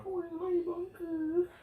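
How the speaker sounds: mock crying.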